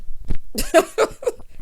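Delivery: sudden loud cackle; laugh. Real, not acted. Host proved right about the quarrel, loud laugh. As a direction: burst out in a breathy laugh.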